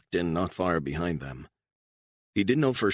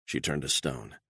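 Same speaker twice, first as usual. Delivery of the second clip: plain reading voice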